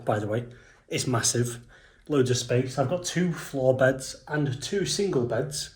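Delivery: usual speaking voice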